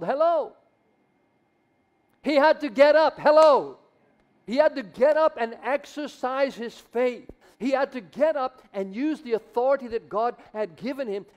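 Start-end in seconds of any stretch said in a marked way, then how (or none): 0.0-9.3 loud, high, fiery preaching; preaching at full force. Real, not acted. Preacher shouting to wake up the congregation. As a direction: drive it home with fire. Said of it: "preaching at full force".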